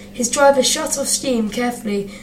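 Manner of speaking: usual speaking voice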